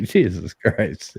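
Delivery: slow exasperation. Retold or imitated. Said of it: "I'm like".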